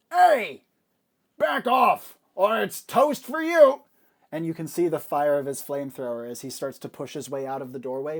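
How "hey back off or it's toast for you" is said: high, very loud threatening bellow; roared threat from a henchman; booming henchman shout. Acted. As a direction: shout it out as a booming warning, last words drawn out. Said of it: "hear the booming voice".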